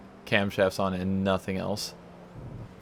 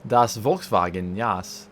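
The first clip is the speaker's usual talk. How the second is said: slow, hammed-up German accent on das Volkswagen; mock German, stretched out slowly; bad German accent; much slower than usual. Mocking the old Volkswagen in a bad German accent. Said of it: "having a terrible German accent".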